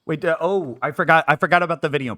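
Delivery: fast and loud, flustered; rushing in a sudden panic. Real, not acted. Suddenly remembers the video.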